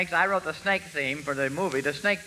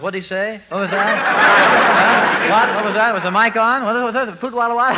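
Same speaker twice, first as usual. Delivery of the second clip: lightly mimicked puzzled crowd, building louder; baffled audience chatter; 4 semitones higher, 8 dB louder than usual. Professor mimics listeners asking each other, mock bewilderment. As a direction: fire off confused questions, getting louder. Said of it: "Everyone turns to everyone else and says".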